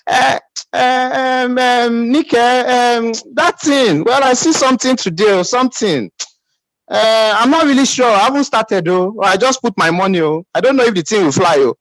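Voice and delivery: hemming and hawing, imitating an unconvinced recruiter; mock hesitant caller. Seminar leader mimics a doubtful member inviting someone, hesitant. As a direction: play someone stalling and unsure of the pitch. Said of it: "you are the one speaking like that".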